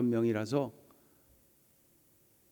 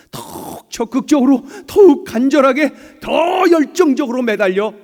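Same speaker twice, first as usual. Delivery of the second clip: building fervour; 7 semitones higher, 9 dB louder than usual. Real, not acted. Building preaching intensity.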